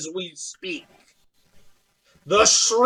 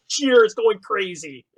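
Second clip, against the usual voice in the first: ordinary talk, nothing marked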